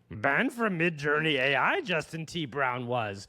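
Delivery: croaky puppet sage with swooping pitch; Yoda. Acted. Host reading a headline live in Yoda voice. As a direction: lilt each phrase upward, trailing a questioning hum. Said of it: "I'm gonna read them as Yoda".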